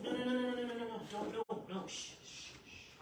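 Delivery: rapid-fire nos tailing into shushing; Satan's discouraging role. Acted. Satan talking someone out of confessing, urgent hushing. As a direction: hush them before they confess. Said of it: "Satan and other people kind of fill that role".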